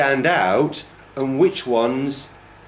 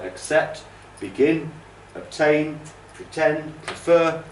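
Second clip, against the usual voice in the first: accept, begin, obtain, pretend, prefer, each stressed at the end, mostly higher; second-syllable stress; 6 semitones higher, slower than usual. Shown as a demonstration. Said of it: "it's normally the second syllable".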